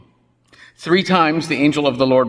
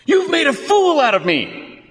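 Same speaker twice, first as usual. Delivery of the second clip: much higher, louder furious threat; unhinged rage; 3 dB louder than usual. Acted. Balaam threatening his donkey in an unhinged, high fury. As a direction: rant like a man who's lost it. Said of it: "Balaam is unhinged".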